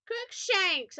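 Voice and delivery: loud, high-pitched shout. A narrator's reading. Narrator shouting at Voldemort, high loud shout. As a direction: hurl it like a spell. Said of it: "I shouted at him".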